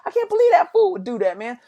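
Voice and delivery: very high, loud outraged squeal; mock furious high-pitched yelling; acted anger. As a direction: lose it in hammed-up disbelief. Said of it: "now I'm getting angry".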